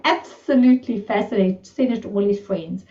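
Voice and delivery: ordinary talk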